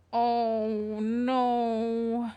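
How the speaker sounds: ordinary delivery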